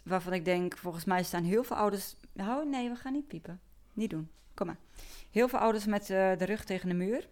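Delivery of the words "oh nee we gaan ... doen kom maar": higher coaxing pet voice; talking to the dog. Real, not acted. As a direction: gently hush a restless dog. Hushing the dog mid-talk.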